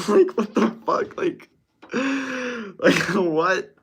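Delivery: high-pitched, laughing disbelief; incredulous laughing. Real, not acted. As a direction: crack up, baffled by it. Cracking up in disbelief.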